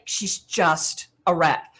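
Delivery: ordinary talk, nothing marked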